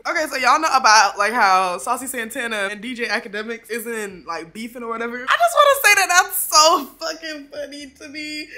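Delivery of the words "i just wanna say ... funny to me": laughing. Real, not acted. Laughing about the beef.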